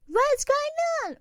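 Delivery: high, loud and slow alarmed cry; startled shriek of alarm. Acted. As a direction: call out in sudden alarm at the intruders. Intruders break into the house.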